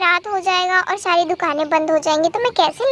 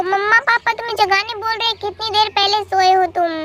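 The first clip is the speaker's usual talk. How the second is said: usual speaking voice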